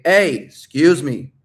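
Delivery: loud, drawn-out attention grab; confident approach call. Shown as a demonstration. Coach shows how to stop a girl, loud confident call. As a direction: call out to stop someone, slowly and loudly. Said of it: "And then you say".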